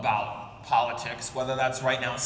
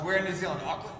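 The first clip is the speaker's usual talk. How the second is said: ordinary delivery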